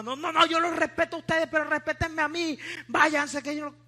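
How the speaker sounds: high, fast, agitated protest in imitation; aggressive. Atheist cutting off evangelists who came to preach, imitated aggressively. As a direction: cut them off angrily, acting out the atheist. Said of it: "Se puso agresiva".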